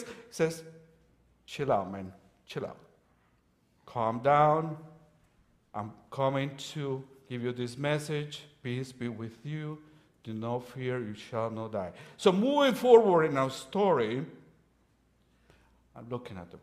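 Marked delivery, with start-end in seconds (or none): 1.5-11.9 soft, low reassuring voice; calming angel. Acted. Angel calming terrified Gideon, soft and low. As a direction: settle the man down gently. Preacher voicing calm angel.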